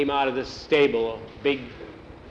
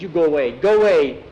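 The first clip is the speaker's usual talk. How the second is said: louder, insistent shooing away; upset rebuff; 2 semitones higher, 6 dB louder than usual. A narrator's reading. Crying boy to Jackie in the guardhouse, upset rebuff. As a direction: push him away with the voice.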